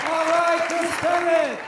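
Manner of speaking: high, loud announcer shout; host's applause call. Real, not acted. Host to audience after comedian's set, rousing. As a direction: call the act's name over applause.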